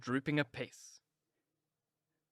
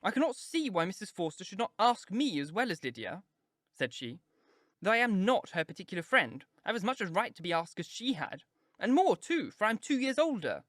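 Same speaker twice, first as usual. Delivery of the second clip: high, whining complaint; peevish; 6 semitones higher than usual. A narrator's reading. Kitty complaining in the parlour, peevish and high. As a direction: whine it out like a jealous sister. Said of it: "her accent was peevish".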